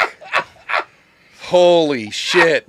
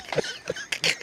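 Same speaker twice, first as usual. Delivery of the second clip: high, quiet wheezing laugh; breathless laughter; 13 dB quieter than usual. Real, not acted. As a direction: laugh until hardly any voice comes out. Cracking up at the garbled name.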